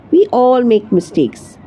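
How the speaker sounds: ordinary delivery, nothing marked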